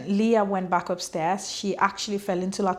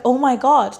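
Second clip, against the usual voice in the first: shocked outcry; 4 semitones higher, 7 dB louder than usual. Retold or imitated. Leah realises Gabe may be dead.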